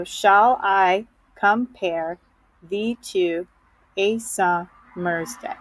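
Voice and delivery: slow, separated syllables with pauses; broken into deliberate little chunks. Shown as a demonstration. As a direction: sound out each syllable slowly, one at a time. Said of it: "it would look something like this".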